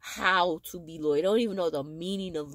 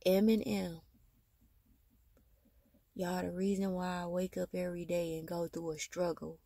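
tender and soft; 9 dB quieter than usual. Real, not acted. Tender words to her kids.

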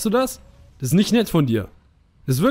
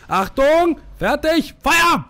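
mock commander; 9 semitones higher, much slower than usual. Enemies in formation giving orders, mock commander shouting. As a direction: play a drill sergeant calling the volley. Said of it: "denken sich so".

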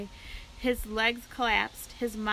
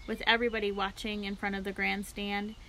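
plain reading voice

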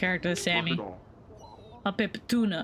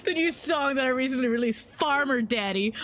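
put-on announcer; 3 semitones higher than usual. Plugging the new song, lightly hammed-up promo voice. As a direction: read it like a cheesy song promo.

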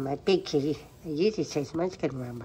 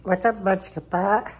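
higher, louder cooing call; 4 semitones higher, 5 dB louder, slower than usual. Real, not acted. Owner answering her meowing cat loudly, baby talk. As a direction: call back to the meowing kitty.

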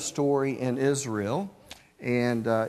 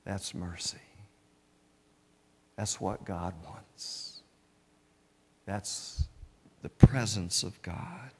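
hushed preacher; 3 semitones lower, 3 dB quieter than usual. Real, not acted. Preacher drops to hush.

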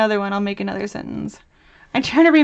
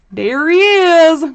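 excited reveal; 7 semitones higher, 10 dB louder, slower than usual. Real, not acted. Reveal of the cane face.

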